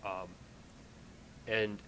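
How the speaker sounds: usual speaking voice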